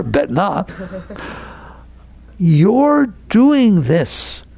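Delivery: normal talking voice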